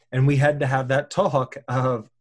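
usual speaking voice

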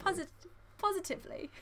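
very high, soft English-accented "positively". Shown as a demonstration. Showing a friend the catchphrase again, in a put-on English accent. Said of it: "say it in an English accent".

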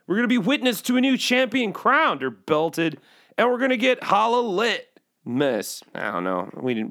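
ordinary talk, nothing marked

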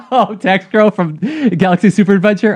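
laughing, caught off guard; laughing surprise. Real, not acted. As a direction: laugh through the question. Co-host asks for a voice.

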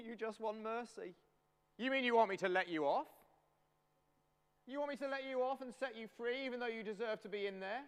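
high-pitched, teasing royal disbelief; mock-incredulous king. King Henry questioning the pleading prisoner, high and incredulous.

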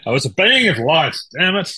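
high, loud hammy lament; overacted death scene. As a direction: ham up a dying lament. Mock dying being of light.

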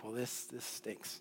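low, slow, very soft mock dejection; deflated grumble. Husband accepting God's unwelcome number, quiet mock dejection. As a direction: let it sink into a quiet acted sulk.

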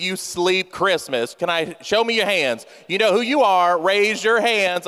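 ordinary delivery

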